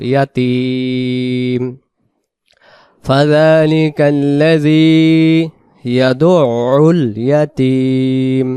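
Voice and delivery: slow, drawn-out chanted recitation; Quran recitation. Shown as a demonstration. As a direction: recite with long held vowels. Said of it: "recites the verse in tajweed". (ধীর তিলাওয়াত)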